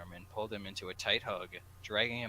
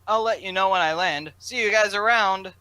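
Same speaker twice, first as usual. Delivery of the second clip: much higher, louder friend voice; high loud farewell; 11 semitones higher, 17 dB louder than usual. Acted. Friend says goodbye at the gate, high and loud.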